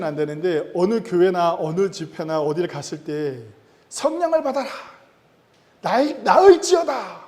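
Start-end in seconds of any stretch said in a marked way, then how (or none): 3.9-7.2 high-pitched, hammed-up healing proclamation; mock faith healer. Pastor mimics false healers praying over people, mock faith-healer proclamation. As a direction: imitate an overblown revival healer, drawing out the command. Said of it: "막 이러면서 기도하고".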